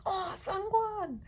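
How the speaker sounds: high, drawn-out fan cry; excited mock yell. Fan spots the photo card held up, mock excited yell. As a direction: imitate a fan crying out, high and slow. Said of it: "K Carrot yell".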